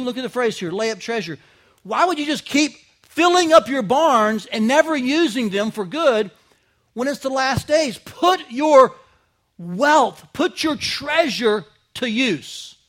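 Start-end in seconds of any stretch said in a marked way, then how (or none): 1.8-12.8 louder, higher rising appeal, slowing on the last line; preaching at full force. Acted. Preacher urging his church to use their treasure, at full force. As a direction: press the church to put its wealth to use.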